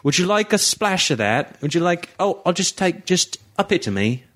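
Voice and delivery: lightly mock-British offering tea; British accent. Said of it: "That sounds like a British thing".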